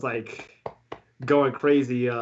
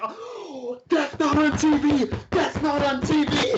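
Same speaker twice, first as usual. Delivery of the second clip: high, squealed mock panic; quiet freak-out; 11 semitones higher, slower than usual. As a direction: freak out in a tiny, very high starstruck voice. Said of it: "I was freaking out very quietly".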